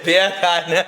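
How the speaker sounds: Beer can through real laughter; laughing. Real, not acted. Friend trying out the Jamaican joke while laughing. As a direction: repeat it while cracking up.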